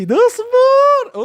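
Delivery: high, slow drawn-out wait; playful high drawn-out call to wait; stretched wait. Acted. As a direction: hold them off with one long high word. Teasing the audience to wait.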